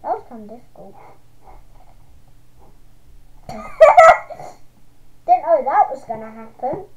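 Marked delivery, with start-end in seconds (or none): 1.0-5.2 loud squealing laugh; high shrieking laugh. Real, not acted. Laughs after tough fight.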